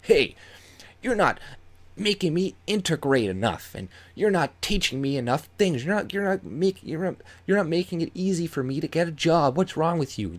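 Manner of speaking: high, sneering complaint voice; mocking whiny immigrant. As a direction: whine it up like a spoiled newcomer. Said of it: "going to other countries and being like".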